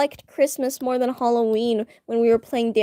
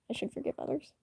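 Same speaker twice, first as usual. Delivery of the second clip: soft confiding whisper; 3 semitones higher, 12 dB quieter, faster than usual. Real, not acted. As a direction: whisper it to yourself. Whispered remark about faith.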